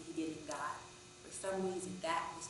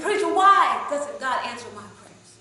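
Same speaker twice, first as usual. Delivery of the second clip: slow, much louder and higher angry yell; furious shout; 5 semitones higher, 20 dB louder than usual. Retold or imitated. Man shouts the question again from the back, slow furious yell. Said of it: "And he shouted again".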